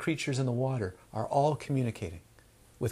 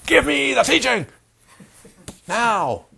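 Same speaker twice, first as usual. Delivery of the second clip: mock demanding shout, higher and louder; impatient student demand; 10 semitones higher, 8 dB louder than usual. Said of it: "They're just screaming".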